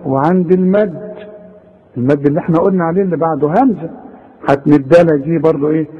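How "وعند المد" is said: ordinary talk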